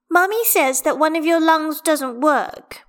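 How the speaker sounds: put-on high, loud childlike explaining; little girl's voice. A narrator's reading. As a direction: report it like a small child. Child repeats what mother said.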